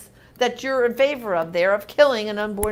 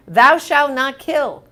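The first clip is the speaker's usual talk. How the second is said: slow and loud, each word weighted; solemn emphasis; 4 semitones higher, 7 dB louder, slower than usual. Real, not acted. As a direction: pronounce it like scripture. Quoting commandment emphatically.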